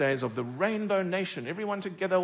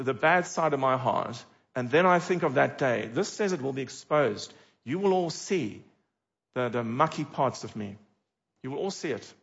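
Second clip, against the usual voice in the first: usual speaking voice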